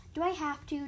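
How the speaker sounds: high, loud kitten moan; whiny protest; moaning complaint.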